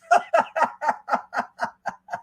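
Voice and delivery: high cackling laugh; long high run of laughs; real laughter. Real, not acted. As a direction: burst out laughing. Laughs at meme.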